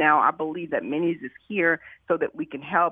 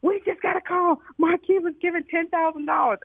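retold excited assistant, much higher voice; excited announcement; 12 semitones higher than usual. Assistant runs over with news of a donation, excited. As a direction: relay big news in a high, thrilled voice.

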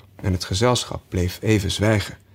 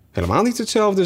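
higher, pointed correction; Hatter objects; 11 semitones higher, faster than usual. A narrator's reading. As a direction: cut her off firmly. Hatter contradicts sharply. (hoger, stellig tegenspreken)

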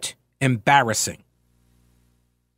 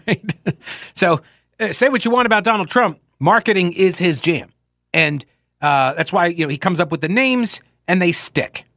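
usual speaking voice

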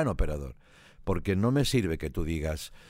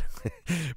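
a quick, soft laugh; real laugh; 9 semitones higher, 3 dB quieter than usual. Real, not acted. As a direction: chuckle at your own joke. Laughs at his own joke. (risita breve)

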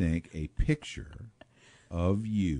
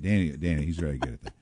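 ordinary delivery

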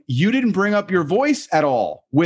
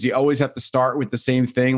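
ordinary talk

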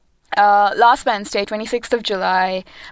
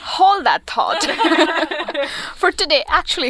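said through laughter, slightly raised; laughing; 8 semitones higher than usual. Real, not acted. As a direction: laugh while putting them off. Laughing at the aliens question.

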